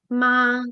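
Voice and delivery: "ma" held long on one steady level tone; first tone. Shown as a demonstration. Teacher shows student the first tone, constant "ma". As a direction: say "ma" drawn out, pitch constant. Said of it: "ma in constant, that is first tone".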